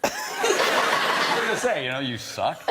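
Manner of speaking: high, slow, laughing mock voice; squeaky put-on voice, half laughing; mock self-talk. Telling how he mocked his own singing in a laughing put-on voice. Mocking himself in retold voice.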